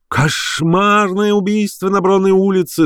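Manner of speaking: high, drawn-out street cry; wailing newspaper crier. A narrator's reading. Newsboys hawking lurid headlines, unnatural wailing. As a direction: wail the headline slowly and high. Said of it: "завывали неестественные сиплые голоса".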